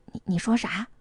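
hushed, stammered question; stunned. A narrator's reading. Mother startled by daughter's remarriage suggestion, stunned and hushed. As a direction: falter softly in disbelief. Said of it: "让秀蜜当场给愣住了".

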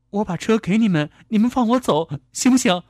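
high, anxious bargaining; tentative pleading. A narrator's reading. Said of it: "试探地问黑脸男人".

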